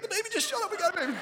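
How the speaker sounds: very high, loud imitation of thrilled children; ecstatic kids. Dad mimics his kids greeting the new baby, ecstatic. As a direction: squeal it like an overjoyed little kid. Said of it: "My kids were so ecstatic".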